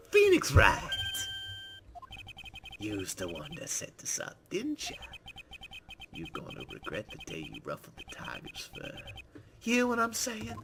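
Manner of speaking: gruff loan shark thug; gangster voice. Acted. Gangster witness Tigre threatens.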